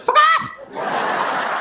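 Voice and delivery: squawked shout, very high; tough chicken. Acted. Tough chicken seeing off any hassle, squawked shout. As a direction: screech it like an angry hen. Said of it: "they're tough, don't give a chicken any hassle".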